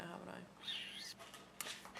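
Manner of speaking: very soft, slow whisper; whispered aside. Real, not acted. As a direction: trail into a breathy little question. Aside to herself or baby.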